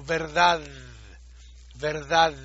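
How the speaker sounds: verdad with a soft th-like d at the end; verdad, the final d light between the teeth; soft interdental d. Shown as a demonstration. Teacher shows the soft Dalet without Dagesh.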